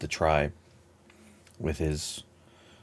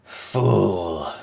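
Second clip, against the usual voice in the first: Palpatine; 2 semitones higher, 4 dB louder than usual. Acted. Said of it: "that Palpatine voice".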